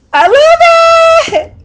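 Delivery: very high, loud, stretched-out cry of joy; ecstatic squeal. Real, not acted. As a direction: squeal it long and loud with delight. Thrilled with seaweed lotion.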